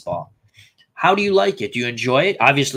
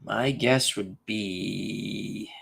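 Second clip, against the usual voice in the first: much slower and softer, trailing into thought; very slow soft musing; 6 semitones lower, 8 dB quieter, much slower than usual. Real, not acted. Still stuck on the trivia answer, soft and very slow. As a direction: murmur it slowly, lost in thought.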